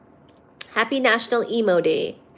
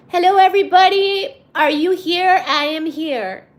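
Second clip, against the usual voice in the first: calling out; 7 semitones higher, 6 dB louder than usual. Real, not acted. Calls out to the live audience.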